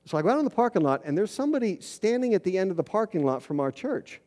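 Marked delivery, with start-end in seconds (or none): none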